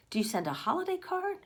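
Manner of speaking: ordinary delivery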